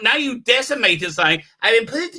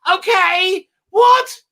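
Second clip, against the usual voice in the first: higher, quick flustered caricature; mock panic; 7 semitones higher than usual. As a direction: play him as a caught boy squeaking. Mocking a showrunner in panic.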